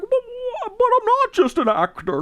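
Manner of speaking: high, stammering old-Hollywood star impression; exaggerated high-pitched protest in character; Jimmy Stewart. Film fan acting out an actor's protest, Jimmy Stewart impression. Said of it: "the James Stewart voice".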